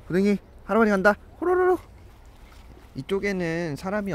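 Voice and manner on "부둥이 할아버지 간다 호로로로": fast, high, loud call to the puppy; calling the dog. Real, not acted. Owner calls Budungi to follow, fast high trill.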